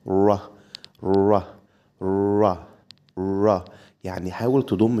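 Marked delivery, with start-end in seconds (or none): 0.1-3.6 «را» with lips rounded, repeated; English R. Shown as a demonstration. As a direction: repeat «را», lips pushed forward. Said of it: "ضم شفايفك شوية".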